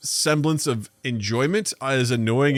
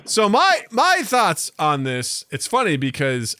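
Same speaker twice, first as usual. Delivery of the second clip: ordinary talk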